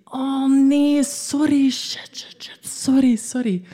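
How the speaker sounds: high, rushed flustered sorrys dropping to a whisper on shit; panicked apologising, caught off guard; imitating a flustered stranger; flustered apology. Flustered apology after stutter remark.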